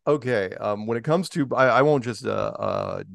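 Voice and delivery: usual speaking voice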